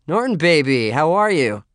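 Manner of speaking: high, loud, gushing welcome; overfriendly greeting. A narrator's reading. Narrator to uninvited guest to avoid trouble, fake-friendly gush. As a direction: greet him with put-on delight. Placating an unwelcome guest at door.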